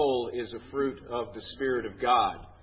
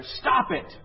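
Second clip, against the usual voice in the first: high, loud retold scream; screamed command; 11 semitones higher, 4 dB louder than usual. Comedy psychiatrist to patient afraid of boxes, screaming. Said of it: "he screams at her".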